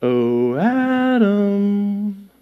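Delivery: sing-song coaxing with long, stretched vowels; mock-sweet, quiet beckoning; God luring Adam out. Shown as a demonstration. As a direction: call softly, drawn out, with a threat hidden inside. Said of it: "God was speaking this way".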